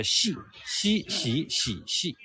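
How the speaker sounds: Mandarin fourth tone on "xi", falling and repeated. Shown as a demonstration. Guide drills the last tone for viewers, falling "xi". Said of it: "falling tone is a".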